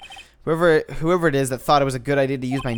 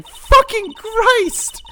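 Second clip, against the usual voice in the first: shocked outburst; 7 dB louder, much slower than usual. Real, not acted.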